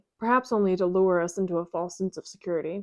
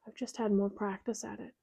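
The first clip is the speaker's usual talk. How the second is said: sad; 9 dB quieter than usual. Acted. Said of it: "Her smile is sad".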